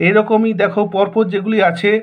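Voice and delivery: ordinary talk, nothing marked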